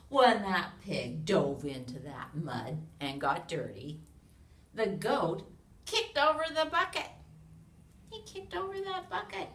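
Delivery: loud, lively storybook read-aloud; animated picture-book narration; reading to children. A narrator's reading. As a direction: read it big for a room of little kids.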